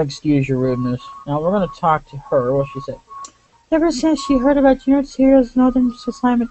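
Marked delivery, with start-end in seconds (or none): 3.7-6.5 high put-on voice for a game attendant; attendant character voice. Attendant reporting on Lady Sonya's health, high put-on voice. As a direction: read it much higher, in character.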